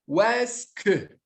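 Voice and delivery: "est-ce que" spelled out slowly, teacher correcting; teacher slowly giving the correct est-ce que; slow corrective est-ce que. Shown as a demonstration. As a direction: drawl "O est-ce que" as the right form. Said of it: "Qu'est-ce que é o quê?".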